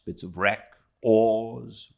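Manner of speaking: ordinary delivery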